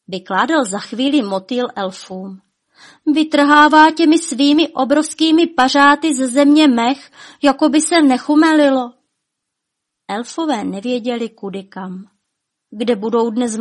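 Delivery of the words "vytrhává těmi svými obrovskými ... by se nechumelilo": high, loud outraged account; indignant report. A narrator's reading. Butterfly describing the dragon tearing moss, indignant.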